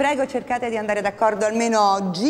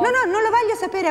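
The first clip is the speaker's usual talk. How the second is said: exasperated; 11 semitones higher than usual. Acted. Teacher cuts off excuse.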